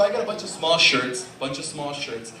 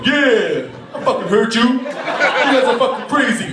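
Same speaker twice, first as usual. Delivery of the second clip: loud, raised-pitch holler through the wall; shouting from next door; 7 semitones higher, 7 dB louder, slower than usual. Acted. Man next door calling out the couple, loud shout. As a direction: yell it over to the neighbours. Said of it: "I hear next door".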